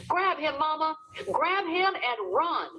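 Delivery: high-pitched desperate scream to Mama. A narrator's reading. Boy screaming at his mother to grab his brother and run. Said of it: "I screamed at Mama".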